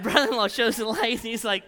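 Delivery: normal talking voice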